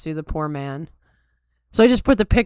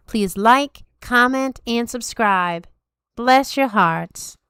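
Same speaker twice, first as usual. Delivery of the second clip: higher-pitched, put-on Southern accent; hammed-up Southern podcast sign-off; 5 semitones higher than usual. Shown as a demonstration. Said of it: "I try to do a Southern accent".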